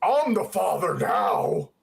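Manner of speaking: slow, low, hammy villain delivery; cheesy villain line. Retold or imitated. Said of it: "he used that cheesy line".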